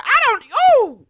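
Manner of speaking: high, loud exasperated cry; a sudden loud, high "ooh" of dismay; exasperated outburst. Real, not acted. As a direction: burst out in disbelief.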